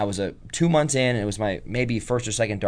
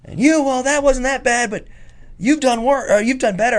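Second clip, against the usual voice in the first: high, louder mocking imitation; Jim Cornette; 13 semitones higher, 4 dB louder than usual. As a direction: pitch it way up, berating a rookie. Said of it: "it makes his voice higher and funnier".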